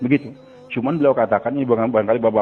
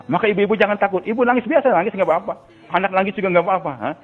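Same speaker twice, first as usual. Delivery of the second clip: preaching; 5 semitones higher than usual. Acted. Preaching to mothers.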